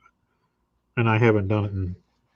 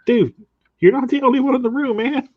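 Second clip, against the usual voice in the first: much higher, louder mock protest; playful scolding, pitched way up; mock exasperated complaint; 5 dB louder than usual. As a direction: call out a streamer in high-pitched, hammed-up exasperation. Acted complaint to noisy streamer.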